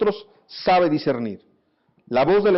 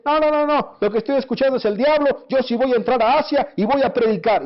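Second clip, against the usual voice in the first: higher-pitched imagined objection; defiant refusal; 6 semitones higher than usual. Pretend. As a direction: insist stubbornly, brushing the warning off. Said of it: "Imagínate que Pablo dice".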